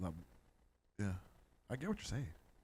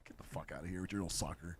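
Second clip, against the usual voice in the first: ordinary talk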